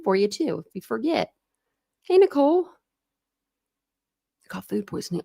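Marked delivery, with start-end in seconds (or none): 2.7-5.2 breathy slow whisper of bad news. Real, not acted. Reading a viewer's bad news, slow sympathetic whisper.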